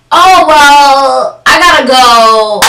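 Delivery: loud, drawn-out exit line; big awkward brush-off; loud excuse to leave. Retold or imitated. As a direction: announce a hasty exit, stretching the words. Retold escape from manager's advance.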